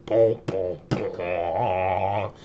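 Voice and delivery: low mock boom boom effect; imitated sound effect. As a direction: voice a Stream Deck drum hit, low. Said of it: "so I can go like".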